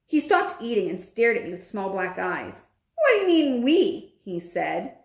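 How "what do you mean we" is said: high-pitched indignant question; indignant challenge. Acted. Bruno to the narrator who calls them both mice, indignant. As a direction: push back, offended. Bruno rejects being grouped with mouse.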